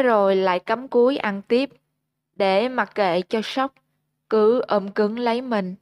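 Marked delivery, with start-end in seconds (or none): none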